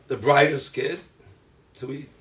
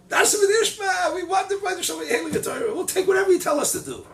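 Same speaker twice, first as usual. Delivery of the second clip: much higher, eager declaration; eager acceptance; 4 dB louder than usual. Acted. Israel accepting the Torah, high and eager. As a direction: pledge it gladly.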